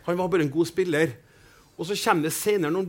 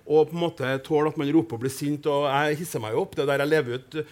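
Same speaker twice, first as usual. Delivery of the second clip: ordinary delivery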